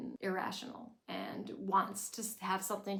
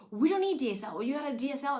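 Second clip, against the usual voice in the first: loud, higher exasperated rant; yelling at mom; 5 semitones higher, 7 dB louder, faster than usual. Retold or imitated. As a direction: go off at her mother. Lorelai yells at Emily.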